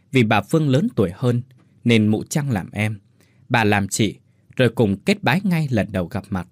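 usual speaking voice

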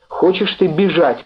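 lightly acted desperate shout; crying out. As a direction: fling the question at him, pitched high. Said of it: "крикнула она снова".

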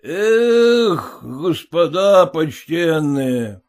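put-on slow, slurred drunk yardman; drunken drawl. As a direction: stretch it out like a drunk. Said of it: "протягивает пьяным голосом".

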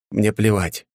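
plain reading voice

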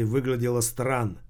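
usual speaking voice

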